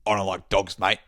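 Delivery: lightly voiced hostile stranger; a hint of a menacing druggie; mock threatening junkie. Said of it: "he goes".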